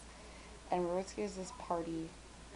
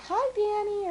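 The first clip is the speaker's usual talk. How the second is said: cheery greeting; 9 dB louder, slower than usual. Retold or imitated. Friends greeting her online.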